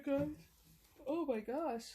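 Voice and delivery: ordinary talk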